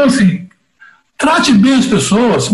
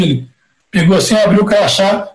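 usual speaking voice

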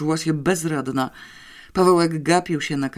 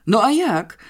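high, loud indignant outcry; a boy's loud, high outraged shout; indignant exclamation; 8 semitones higher, 6 dB louder than usual. A narrator's reading. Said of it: "wykrzyknął z oburzeniem Pawełek".

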